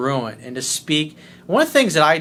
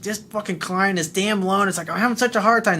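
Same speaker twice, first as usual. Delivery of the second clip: imitated griping; 4 semitones higher than usual. Acts out employee griping before call.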